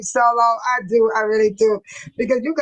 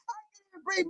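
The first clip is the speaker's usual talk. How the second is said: breathless laughter; 6 semitones higher, 12 dB quieter, much faster than usual. Real, not acted. After laughing hard at chat.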